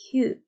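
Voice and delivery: "Hu" sounded alone as one short vowel; isolated vowel demo. Shown as a demonstration. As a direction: give "Hu" as a brief, separate teaching sound. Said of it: "how to pronounce each one of the vowels".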